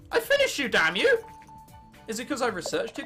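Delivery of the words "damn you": ordinary talk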